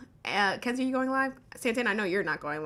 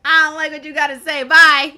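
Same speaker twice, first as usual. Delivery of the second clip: loud mocking dismissal, higher and louder; mock yell; 7 semitones higher, 17 dB louder than usual. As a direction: bark it out like a petty host slamming the door. Mocking the host who kicked her.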